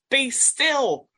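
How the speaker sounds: Be still shouted high and loud; commanding shout. Shown as a demonstration. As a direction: hurl "Be still" at the storm. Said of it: "liken it to Jesus... in the storm".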